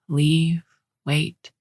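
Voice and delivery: usual speaking voice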